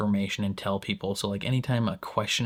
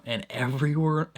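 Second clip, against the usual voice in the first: ordinary talk, nothing marked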